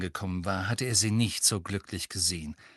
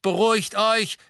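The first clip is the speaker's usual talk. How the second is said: raised, high call to settle down; 11 semitones higher, 7 dB louder than usual. A narrator's reading. Mister Weasley hushing the children, calling out. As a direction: call out over the noise, loud and high. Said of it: "rief Mister Weasley, doch auch er lächelte".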